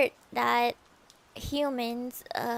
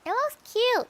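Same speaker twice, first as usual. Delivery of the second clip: "cute" squealed high and bright; 10 semitones higher, 3 dB louder than usual. Real, not acted. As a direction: coo the word "cute" up high, then drop.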